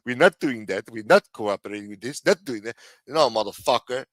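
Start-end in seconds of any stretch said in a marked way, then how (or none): 0.0-2.8 mock a stubborn Frenchman; french chef. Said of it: "the fucking French tell us".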